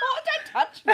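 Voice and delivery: loud squealing laugh; laughing shriek. Real, not acted. Squealing as head sweat flicks.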